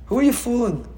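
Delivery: normal talking voice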